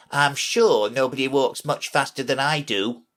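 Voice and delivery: a mildly sulky protest. A narrator's reading. Messenger insisting he walks fast, sulky. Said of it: "said in a sulky tone".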